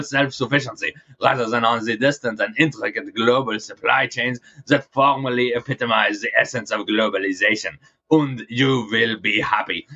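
mock German accent; Klaus Schwab accent. Host mocking the World Economic Forum boss, in a German accent. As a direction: put on a hammed-up German accent. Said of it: "I'll do it in my best Klaus Schwab accent".

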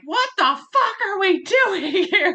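retelling her own exasperation at the meetings; exasperated outburst. Retold or imitated. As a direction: throw up your hands and laugh at the mess. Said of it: "And I'm just like".

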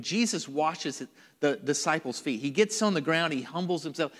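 normal talking voice

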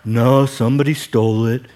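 low, sulky donkey whine; Eeyore voice. Retold or imitated. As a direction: complain like a gloomy Eeyore. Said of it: "That's his first line that shows up".

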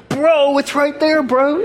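high, slow, awestruck bro voice; viral video bro. Retold or imitated. Storm watcher imitating viral video guys seeing the tornado, high awestruck bro voice. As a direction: do the guys from the Twitter video, stunned. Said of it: "I'm like those guys in that video".

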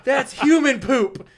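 high, loud and slow, laughing through it; kid's disgusted cry. Retold or imitated. Camper finds poop by his pillow, disgusted laughing cry. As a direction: play the grossed-out kid, half laughing.

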